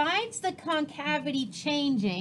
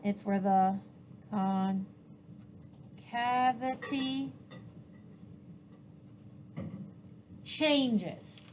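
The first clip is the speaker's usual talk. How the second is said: broken up with long pauses for writing; 4 semitones lower, 7 dB quieter than usual. Real, not acted. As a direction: dictate it piece by piece as you write. Repeats the phrase while writing it.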